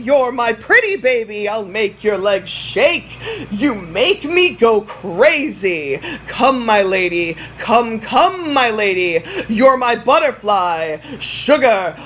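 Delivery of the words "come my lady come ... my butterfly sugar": ordinary talk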